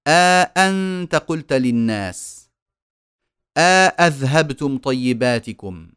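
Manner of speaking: slow, each opening أ held long before the second hamza; long alif inserted. Shown as a demonstration. Teacher giving idkhal examples, long aa between hamzas. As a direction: stretch aa in أ أنت and أ أذهبتم. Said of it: "إدخال ألف بين الهمزتين".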